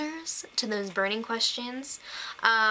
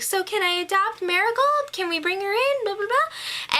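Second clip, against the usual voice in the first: higher-pitched pleading quote; Edith; 8 semitones higher than usual. Retold or imitated. Edith asking the family to adopt Marigold, higher mock pleading. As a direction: lightly imitate someone begging permission, voice lifted.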